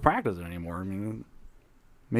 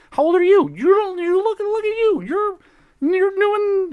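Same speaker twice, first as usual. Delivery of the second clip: high excited squeal; 8 dB louder than usual. Acted. Player voicing excited character.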